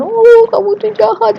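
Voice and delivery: hammed-up weepy reproach; mock crying. Shown as a demonstration. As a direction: pretend to tear up and accuse. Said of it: "sedih langsung nangis".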